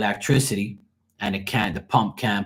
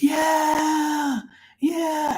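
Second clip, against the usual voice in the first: crowd cheer. Retold or imitated. Neighbours cheering when the power comes back, high whooping.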